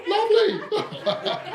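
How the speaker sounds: high, drawn-out society lady with a laugh; posh poodle owner. Acted.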